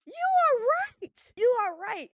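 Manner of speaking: very high, emphatic agreement; high-pitched mock concession. Host agreeing with a commenter, high exaggerated concession. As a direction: leap up high and punch each word, hammed up. Acts out his reaction to comment.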